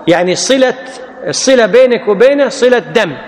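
ordinary delivery

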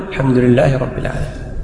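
soft, low echo of the imam; follower's subdued repetition; follower repeating. Shown as a demonstration. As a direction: repeat it under your breath after the imam. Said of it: "أنت تقول".

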